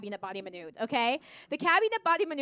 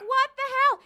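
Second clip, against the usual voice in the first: mock outrage; 11 semitones higher, 7 dB louder than usual. High, loud retold outrage.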